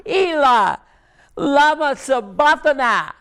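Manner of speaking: cried out high and strained, in Aramaic; anguished scream. Acted. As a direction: wail it out as if from the cross. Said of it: "Jesus screams out at the top of his lungs".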